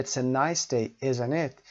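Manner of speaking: slow "nice day", then "isn't it" falls; falling tag. Shown as a demonstration. Teacher modelling a tag that seeks agreement, falling. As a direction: voice goes down on "isn't it". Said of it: "falling intonation".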